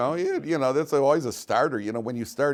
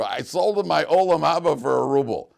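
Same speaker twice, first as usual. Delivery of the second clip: higher, louder punchline delivery; retold boast; 6 semitones higher, 7 dB louder than usual. Husband tells his wife the funniest story, boasting loudly.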